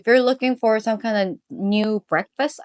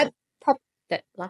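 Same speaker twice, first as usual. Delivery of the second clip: put-on Malaysian accent; much faster than usual. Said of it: "why am I speaking in Malaysian accent".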